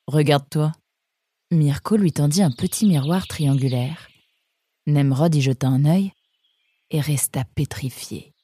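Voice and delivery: ordinary delivery, nothing marked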